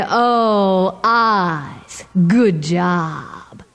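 low, satisfied praise; pleased. Acted. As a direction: praise them, low and content. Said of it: "Coyote said".

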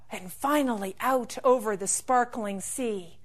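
usual speaking voice

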